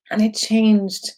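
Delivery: high voice welling up again with feeling; overcome, slow and high-pitched; real tearful emotion. Real, not acted. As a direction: say it through rising tears. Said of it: "I guess I'm crying because".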